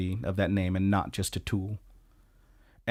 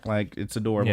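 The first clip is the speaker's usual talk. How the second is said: normal talking voice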